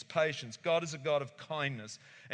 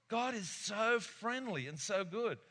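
normal talking voice

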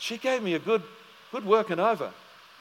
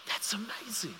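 amazed whisper; 13 dB quieter than usual. Retold or imitated. Her amazed reply retold.

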